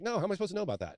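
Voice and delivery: how could I possibly know, said high; incredulous reply. Retold or imitated. Retold self, incredulous reply.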